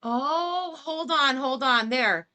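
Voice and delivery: loud, urgent call to stop; excited loud interruption; sudden hold on. Real, not acted. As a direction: halt everything, you just caught something big. Stops the clip to catch something.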